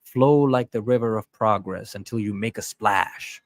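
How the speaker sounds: ordinary talk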